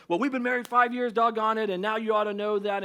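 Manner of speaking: a put-upon spouse griping; mock indignant nagging; exasperated spouse.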